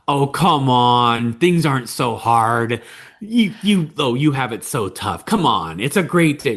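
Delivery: dismissive parent. Acted. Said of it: "if my child's very frustrated".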